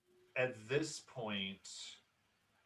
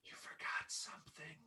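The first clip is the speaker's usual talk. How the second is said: put-on high, breathy whispered child's voice; little girl voice; 12 semitones higher, 11 dB quieter than usual. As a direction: whisper it like a tiny ghost girl. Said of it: "This little, tiny girl voice".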